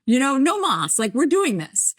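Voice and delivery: high-pitched putting a foot down; mock exasperated, declare it decided; fed up. Retells putting her foot down.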